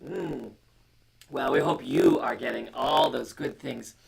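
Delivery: plain reading voice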